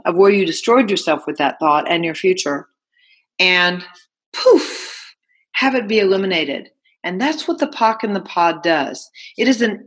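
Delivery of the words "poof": breathy poof. Acted. Magic vanishing of limiting thought.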